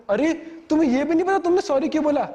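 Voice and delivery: high-pitched mock outrage; imitated indignant girlfriend. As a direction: mimic an offended girlfriend, voice jumping up. Acts out girlfriend's indignant challenge. (नाराज़ girlfriend की ऊँची आवाज़ में नकल)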